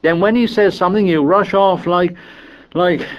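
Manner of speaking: high, heated scolding tirade; exasperated boy telling everyone off; Piggy. A narrator's reading. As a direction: rant on indignantly until the breath runs out. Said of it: "listening to the tirade".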